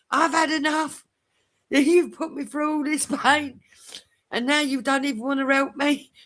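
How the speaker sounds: high-pitched retold complaint; mock indignant protest. Acted protest to the nurse.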